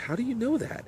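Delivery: very high, incredulous question; baffled disbelief. Acted. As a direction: act astonished that she could know. Arnold baffled by her impossible knowledge.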